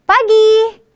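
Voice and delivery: high, breezy one-word hello; casual greeting. Shown as a demonstration. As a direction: toss it off casually to a friend. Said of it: "how about if I just say".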